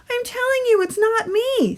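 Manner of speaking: put-on a kid insisting it wasn't him; protesting boy. A narrator's reading. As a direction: Stuart protesting on his way to the principal, high voice. Stuart protests innocence.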